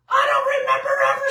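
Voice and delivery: very high, fast exclamation; high-pitched disbelief. Real, not acted. Lecturer recalling school days without inhalers, high-pitched disbelief. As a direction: shoot way up high and rush it out in disbelief.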